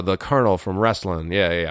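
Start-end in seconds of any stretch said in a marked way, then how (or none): none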